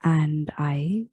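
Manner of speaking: very slow meditation voice with long pauses; unhurried body scan, each phrase left to settle; guided relaxation. Real, not acted. Yoga teacher guiding listeners into final relaxation, slow meditative delivery.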